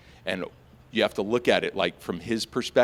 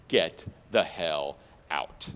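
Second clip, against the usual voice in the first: gruff dismissal; 8 semitones lower, slower than usual. Retold or imitated. Cop's head shake meaning.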